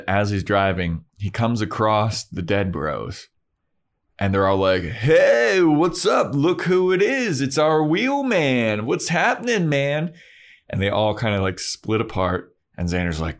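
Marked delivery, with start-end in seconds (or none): 4.8-10.1 goofy bro voice. Retold or imitated. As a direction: play a chummy zombie frat bro calling out. Zombie bros greeting Xander.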